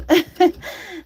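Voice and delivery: high, loud burst of laughter; rueful laugh. Real, not acted. Woman laughing at getting eaten alive by bugs, rueful laugh. Laughs at own bug complaint.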